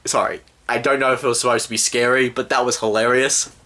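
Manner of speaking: usual speaking voice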